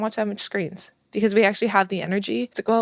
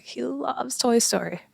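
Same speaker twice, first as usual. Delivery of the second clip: choked up; 5 semitones higher, slower than usual. Real, not acted. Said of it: "I'm getting, like, all emotional".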